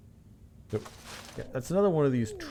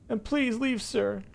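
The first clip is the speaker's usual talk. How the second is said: very high, strained character voice; high-pitched, barely polite dismissal; hateful man behind door; 13 semitones higher, slower than usual. Acted. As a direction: dismiss them in a high, seething voice. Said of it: "His voice throbs with pure".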